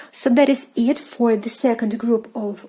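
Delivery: normal talking voice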